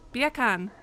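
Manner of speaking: attempted British accent on "Beer can". Shown as a demonstration. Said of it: "in a British accent".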